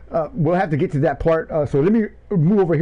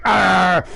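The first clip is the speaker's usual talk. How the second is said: drawn-out "arr" roar, higher pitched; devil dog growl; 4 semitones higher, 4 dB louder than usual. Acted. Commentator painting the Pale Rider in lightning, theatrical roar. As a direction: let loose a dramatic monster snarl.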